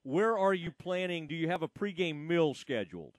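normal talking voice

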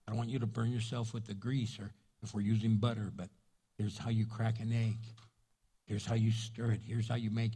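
soft, patient grandpa explaining to his granddaughter; gentle teaching. Retold or imitated. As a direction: coax a child through cooking eggs.

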